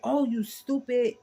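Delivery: imitating drivers cursing each other; mock cussing out. Angry drivers after a crash, mock cussing. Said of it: "they cursing each other out".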